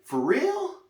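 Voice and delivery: high, rising mock disbelief; incredulous question. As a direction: voice a stunned viewer, pitch leaping upward. Voicing viewer's disbelief.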